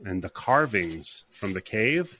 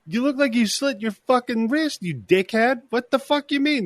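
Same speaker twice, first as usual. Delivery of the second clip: mock outraged; 12 semitones higher than usual. Mike berating Chris.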